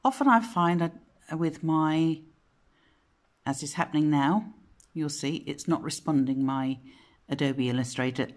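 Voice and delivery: usual speaking voice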